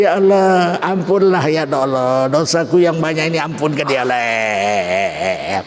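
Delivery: drawn-out mock weeping, ending in a long bleating wail; pretend crying. Shown as a demonstration. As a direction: fake tearful wailing prayer, sinking low. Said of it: "Waktu doa pura-pura nangis".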